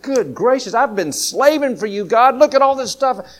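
high, loud, whiny grievance; a put-upon brother airing his grudge to God; resentful complaining. Acted. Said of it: "like this older brother".

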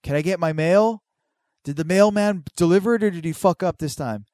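high, loud acted call through the house; calling to mom. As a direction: holler a question to your mother.

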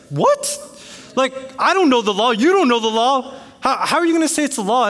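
very high, loud, exasperated protest; indignant outburst. Retold or imitated. Homeowner disputing neighbor's claim about the law, indignant. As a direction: blurt it out in outraged disbelief.

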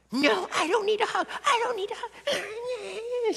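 very high mock toddler wail; imitated hysterical crying. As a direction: imitate a child's shrill tantrum, drawing out the last words. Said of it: "she's crying hysterically".

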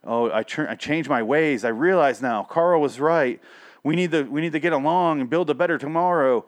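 lightly hammed-up change of heart; mock epiphany. Reviewer mocking the hero's contrived redemption, lightly hammed-up. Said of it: "like, a weird, like".

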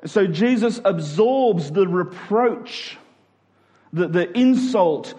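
ordinary talk, nothing marked

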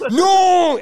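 high, loud drawn-out yell; big movie-style "no"; dramatic shouted refusal. Acted. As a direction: cry out in mock outrage. Acts out fight over cheesecake.

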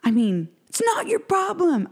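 hammed-up angry yell; angry man yelling. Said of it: "he just keeps yelling".

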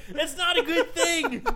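high, slow, laughing on "not"; laughing verdict. Real, not acted.

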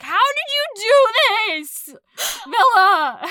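high, loud, drawn-out playful yelling; thrilled, overblown cry of disbelief; mock yell. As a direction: yell it at her in mock outrage. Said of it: "yelled at her for a solid hour".

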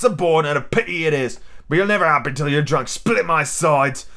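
pirate ringleader, scornful. Acted. Silver rails at his drunken crew, scornful. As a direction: berate the crew as an old pirate.

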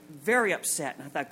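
ordinary delivery

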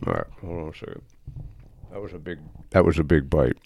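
talking while chewing; words round a mouthful of brownie. Real, not acted. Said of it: "Can you hear me chewing into the microphone?".

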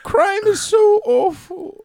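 high, slow lamenting character; put-on character voice. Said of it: "Would you do the other voice".

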